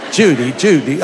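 hammy old film-star impression; mock leading-man voice; Cary Grant. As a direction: do a classic movie-star catchphrase. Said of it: "I sound more like Cary Grant".

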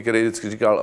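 ordinary delivery

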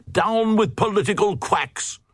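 high, odd crowd voice crying out; crying Glugs. A narrator's reading. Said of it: "the Glugs cried".

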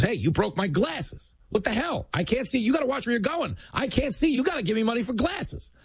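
high, hammed-up indignant hustler; street hustler. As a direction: whine like a con man playing the victim. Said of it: "and goes".

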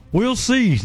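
high twangy country singer voice; playful country star imitation; [name] impression. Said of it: "Because she said".

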